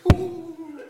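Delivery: high, loud laughing whoop; a startled translator yelps at the roaring lion; mock startled whoop. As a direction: yelp like a lion just appeared. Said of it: "and he goes".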